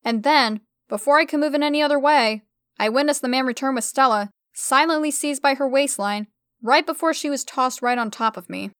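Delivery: ordinary delivery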